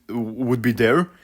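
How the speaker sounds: ordinary talk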